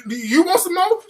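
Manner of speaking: stammering, high-pitched; stuttering. Retold or imitated. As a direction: stumble on you, then push the question. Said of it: "I'm stuttering now".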